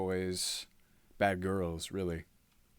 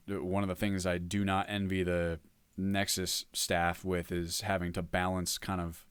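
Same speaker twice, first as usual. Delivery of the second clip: normal talking voice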